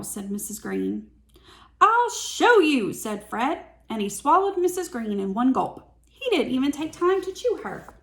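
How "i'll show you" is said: high, loud, slow indignant shout; hammed-up dragon fury; angry dragon. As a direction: bellow it like an insulted dragon.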